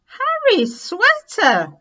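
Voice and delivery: high, loud, slowing shout; excited shout. A narrator's reading. Children shouting that the nest is Harry's sweater, loud. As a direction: shout it out together in discovery. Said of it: "they shouted together".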